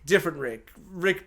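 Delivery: normal talking voice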